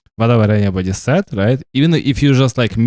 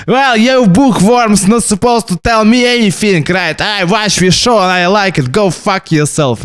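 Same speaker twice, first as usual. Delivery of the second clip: much higher, loud indignant rant at book fans; mock angry retort; 10 semitones higher, 4 dB louder than usual. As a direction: blast the know-it-all fans, go fuck yourself slowed.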